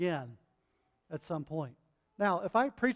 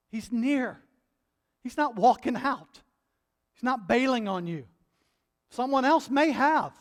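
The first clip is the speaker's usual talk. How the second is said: louder, higher preaching build; impassioned preaching; 5 semitones higher, 7 dB louder than usual. Acted. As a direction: press the promise home with fervour. Pastor preaching at full force.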